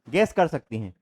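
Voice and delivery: ordinary talk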